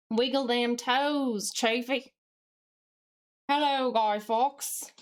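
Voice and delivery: slow, drawn-out mock Southern drawl; Southern accent. Streamer paying off a viewer redeem, slow put-on Southern accent. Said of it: "speaking in a Southern accent".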